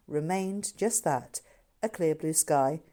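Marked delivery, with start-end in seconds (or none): none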